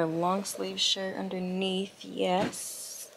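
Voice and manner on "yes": ordinary delivery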